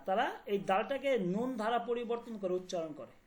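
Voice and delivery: ordinary talk, nothing marked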